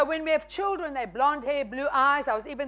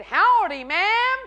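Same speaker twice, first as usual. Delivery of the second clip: a hint of a Texan drawl, louder; Texan howdy; 6 semitones higher, 7 dB louder, much slower than usual. Texan man greets the preacher, lightly voiced cowboy. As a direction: greet her like a big cowboy.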